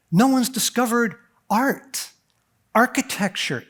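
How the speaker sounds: ordinary talk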